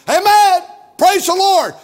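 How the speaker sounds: shouted amen and praise the Lord, high and loud. Retold or imitated. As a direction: holler it like an eager churchgoer. Imitating loud amen-sayer in church.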